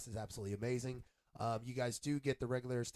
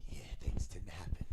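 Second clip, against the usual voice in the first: whispered aside; 7 semitones lower, 3 dB quieter than usual. Real, not acted. Aside about late start.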